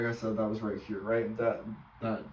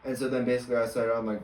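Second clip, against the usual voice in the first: usual speaking voice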